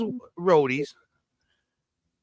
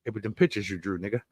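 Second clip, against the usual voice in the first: mock condescending; 4 semitones lower, 4 dB louder, faster than usual. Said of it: "Neil deGrasse Tyson put a button on it".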